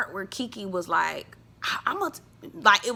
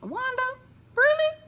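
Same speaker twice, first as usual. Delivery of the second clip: high, disbelieving call-out; calling out Wanda's irony; incredulous. Real, not acted. Mocking aside to Wanda.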